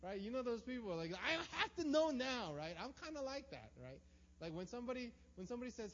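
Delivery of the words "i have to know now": loud, high mock-frantic demand; demanding an answer. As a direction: insist like an anxious controlling person. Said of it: "they have to have an answer".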